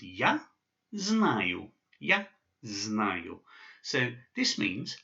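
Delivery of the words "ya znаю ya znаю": "ya znаю" said ya znayu; "ya znаю" with the ending clearly spoken; Russian phrase. Shown as a demonstration. Teacher pronouncing "ya znаю" for students. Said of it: "this phrase is pronounced".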